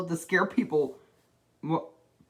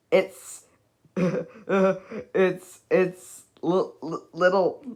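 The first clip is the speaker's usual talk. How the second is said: stammering in fear; 3 semitones higher, 3 dB louder, much slower than usual. Acted. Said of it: "chattered Mister Jelly, trembling in fear".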